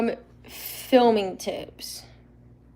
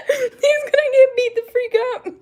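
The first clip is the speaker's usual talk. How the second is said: much higher, squealing through laughter; laughing; 4 dB louder than usual. Real, not acted. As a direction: giggle the spoiler out. Laughing at villain plan.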